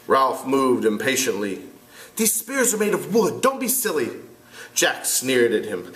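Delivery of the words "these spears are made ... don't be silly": put-on boy voice. Ralph voiced.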